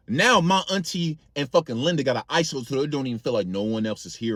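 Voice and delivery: ordinary talk, nothing marked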